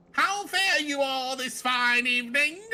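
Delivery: put-on British accent. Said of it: "I gave him a British accent".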